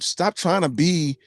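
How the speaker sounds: ordinary talk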